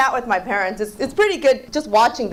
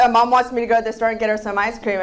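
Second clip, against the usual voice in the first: normal talking voice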